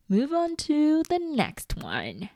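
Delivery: high, slow, bright section announcement; upbeat announcer. Acted.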